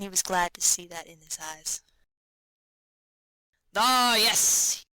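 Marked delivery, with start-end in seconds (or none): 3.8-4.8 Yashvin's hearty voice. A narrator's reading. Yashvin greets Vronsky's news.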